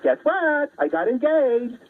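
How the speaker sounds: put-on high squealing girly announcement; hammed-up giddy falsetto, drawn out at the end; mock excited bride-to-be. Woman telling girlfriends she's engaged, mock excited. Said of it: "A woman goes to her group of girlfriends and says".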